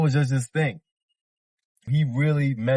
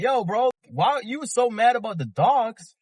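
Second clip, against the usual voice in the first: mock disbelief, pitched much higher; a retold baffled callout, higher up; incredulous question; 11 semitones higher than usual. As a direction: ask it like you can't believe him. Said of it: "I'm like".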